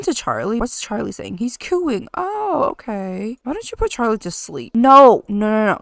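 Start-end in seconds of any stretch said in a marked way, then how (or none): 4.7-5.8 loud sudden no; sharp no. Real, not acted. Mom shouting no at the disobeying kid. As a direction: cut her off loudly. Stopping kid going to treehouse.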